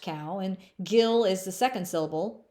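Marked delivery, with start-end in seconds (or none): 0.0-0.4 "cow" said slowly and clearly, like the animal; pronouncing cow. Shown as a demonstration. Counselor teaching fifth graders her name, careful "cow". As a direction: spell out "cow" as the first syllable of the name. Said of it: "it's pronounced".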